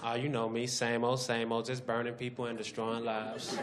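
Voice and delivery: low, soft, laid-back dude; mumbled low slacker; herpes voice. Acted.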